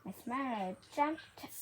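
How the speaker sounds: usual speaking voice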